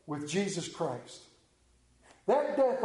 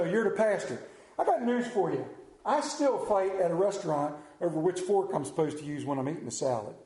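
normal talking voice

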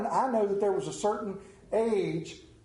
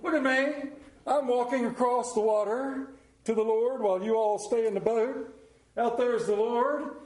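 higher, proud Peter boasting on the water; show-off Peter; 5 semitones higher than usual. Shown as a demonstration. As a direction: strut it like Peter showing off. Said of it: "show you the Peter walk".

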